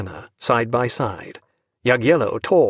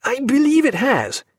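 dismayed; 12 semitones higher than usual. A narrator's reading. Doctor finds his watch drenched, high and dismayed. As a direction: realize the watch is ruined. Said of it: "groping in his fob".

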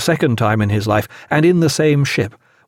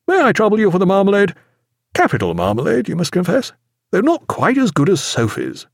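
light, airy breakfast chat, pitched up; breezy captain, higher and lilting; insouciant captain; 7 semitones higher than usual. A narrator's reading. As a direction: chat as if nothing were wrong. Captain chats over marmalade.

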